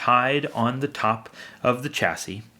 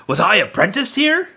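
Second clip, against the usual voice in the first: excited; 8 semitones higher, 5 dB louder than usual. A narrator's reading. Scrooge surprised at the warehouse.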